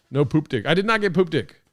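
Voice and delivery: emphatic loud protest; loud denial. Real, not acted. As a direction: insist loudly, almost shouting.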